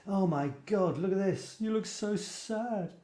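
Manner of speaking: lightly imitating a friend's fond complaint; Despina's mock dismay. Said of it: "moaning at me".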